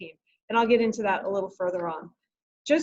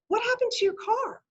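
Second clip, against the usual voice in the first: much higher-pitched retold demand; exasperated question; 13 semitones higher than usual. Teacher cutting through a flustered student's rambling story, exasperated. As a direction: press the question in a high, exasperated voice.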